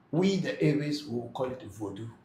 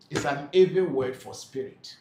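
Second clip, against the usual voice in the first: usual speaking voice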